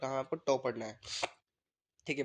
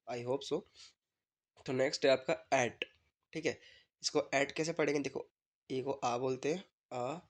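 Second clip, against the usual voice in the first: usual speaking voice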